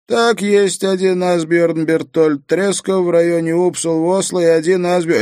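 high, loud nasal operator; nasal phone voice, pitched well up. A narrator's reading. Operator reading out listings to Harry, nasal and high. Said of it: "сказал гнусавый голос".

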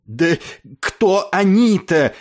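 high, loud, drawn-out indignant question; loud, laboured cry of outrage; angry breathless outcry. A narrator's reading. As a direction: force the shout out through choking anger. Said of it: "удалось всё же выкрикнуть ему сквозь гневную одышку".

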